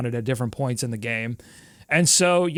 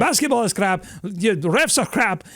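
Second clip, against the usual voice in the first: lightly imitated Russian-accented rant, pitch raised; mock Russian accent; 4 semitones higher than usual. Coach rants about the referees, lightly mimicked Russian accent. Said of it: "in this broken English, Russian accent".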